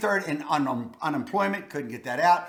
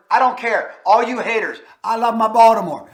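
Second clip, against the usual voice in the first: loud, higher-pitched, emphatic declaration; defiant hometown pride; 4 semitones higher, 8 dB louder than usual. Real, not acted. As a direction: shout down the haters. Defiantly defends hometown against critics.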